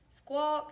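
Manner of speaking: high, loud squawk; chicken squawk. A narrator's reading. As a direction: squawk it like a hen. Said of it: "said Chicken".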